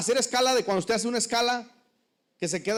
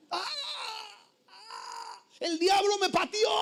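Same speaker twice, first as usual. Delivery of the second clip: higher hammed-up cry of pain; mock wail; 10 semitones higher, 4 dB quieter, slower than usual. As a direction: fake an injury like Neymar. Faking a foul like Neymar.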